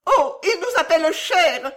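very high, loud outraged squeal; scandalised cry. A narrator's reading. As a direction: cry out, scandalised at being addressed so. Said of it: "cria la plus laide et la plus vieille".

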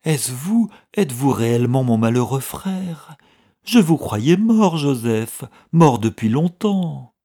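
high, loud, emotional exclamations; shocked disbelief. A narrator's reading. Old clerk to the brother he thought dead, shocked disbelief. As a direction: stretch the words in stunned agitation.

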